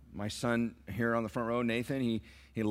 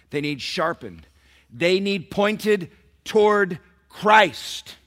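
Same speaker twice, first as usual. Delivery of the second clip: much higher, much louder, slowed on key words; preaching at full force; 7 semitones higher, 10 dB louder, slower than usual. Acted. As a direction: build to a shouting climax. Preaching climax.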